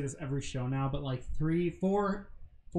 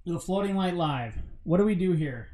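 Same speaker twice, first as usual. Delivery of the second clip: ordinary talk, nothing marked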